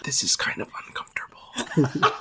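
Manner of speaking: breathy mock ASMR whisper. Friends joking about ASMR, mock whisper.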